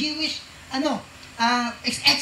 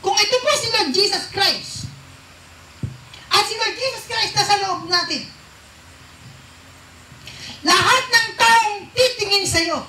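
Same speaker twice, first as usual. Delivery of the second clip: high, loud, slowed preacher's cry with a laugh; preaching climax; 7 semitones higher, 4 dB louder than usual. Acted. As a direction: lift the congregation with joyful force. (masayang sigaw ng pangangaral)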